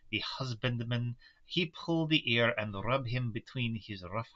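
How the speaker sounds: put-on light German-Russian mixed accent; old Dutch professor, lightly accented; Van Helsing accent. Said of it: "the accent I picked for Van Helsing".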